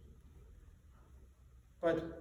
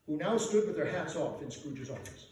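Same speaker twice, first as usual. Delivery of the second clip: usual speaking voice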